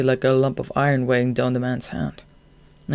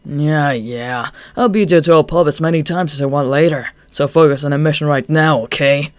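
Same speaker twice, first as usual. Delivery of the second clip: loud, annoyed scolding; reproachful; 6 dB louder than usual. Acted. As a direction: snap at him to focus. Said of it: "reproachful gaze".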